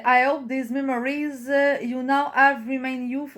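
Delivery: halting, slow English with a Québécois accent; laboured French-accented English reading; struggling French-Canadian accent. Real, not acted. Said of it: "j'ai bien de la misère".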